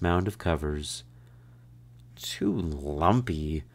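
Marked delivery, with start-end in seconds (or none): 2.2-3.6 grumbling. A narrator's reading. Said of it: "she grumbled".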